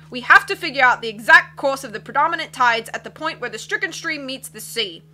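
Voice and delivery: loud, hearty captain voice; blustery sea captain. Acted. Captain puzzling over charts, loud and hearty. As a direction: boom like a cheerful old sea dog.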